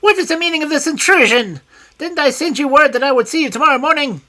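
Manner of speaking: high, loud indignant cry; shrill outraged little man; high screaming voice. A narrator's reading. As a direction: shriek at the intruder. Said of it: "in his high, screaming voice".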